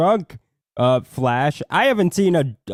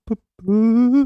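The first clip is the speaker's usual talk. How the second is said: silly put-on voice; 9 semitones higher, 4 dB louder than usual. Mock caller voice.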